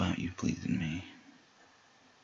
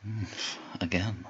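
ordinary delivery

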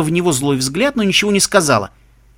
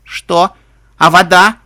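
loud startled questions after tears; sniffling disbelief, raised voice; 2 semitones higher, 8 dB louder, much slower than usual. A narrator's reading. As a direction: blurt it out, still upset. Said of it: "хлюпнул носом Скуталу".

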